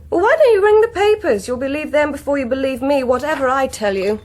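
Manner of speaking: loud, bitter, high retort; furious daughter shouting down her father; angry outburst. Acted. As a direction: hurl it back at him.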